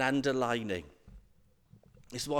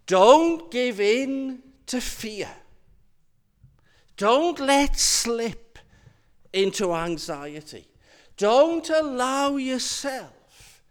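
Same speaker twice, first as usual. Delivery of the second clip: slow, loud, raised pleading urgency; slow emphatic urging; 7 semitones higher, 6 dB louder, slower than usual. Acted. Preacher urging his flock, slow and emphatic. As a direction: press each don't home, slowly.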